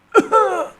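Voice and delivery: pained cough. A narrator's reading. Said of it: "fist hit it".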